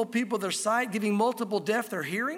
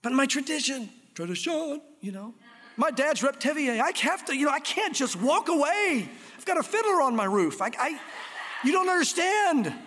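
higher-pitched, hammed-up pleading over tradition; silly mock protest; 5 semitones higher than usual. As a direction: imitate someone protesting with comic exaggeration. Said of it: "I'm being silly about it".

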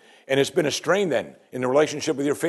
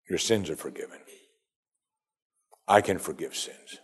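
low, soft pronouncement with pauses; quiet authority; 8 semitones lower, 8 dB quieter than usual. Acted. Preacher quotes Jesus forgiving sins, quiet authority. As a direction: let the claim fall softly, with weight. Voices Jesus's weighty claim, hushed.

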